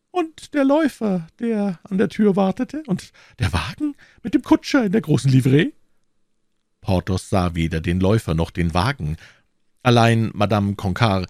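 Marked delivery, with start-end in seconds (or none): 0.1-5.7 very high falsetto lady, pointed; jealous procurator's wife. Acted. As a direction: needle him in a high wifely voice. (eifersüchtige Frau in hoher Fistelstimme)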